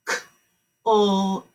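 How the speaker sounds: K-O-F said sound by sound, each drawn out; segmenting sounds slowly; sounds stretched apart. Shown as a demonstration. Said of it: "Now I'm gonna stretch it".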